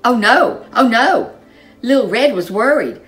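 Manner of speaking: usual speaking voice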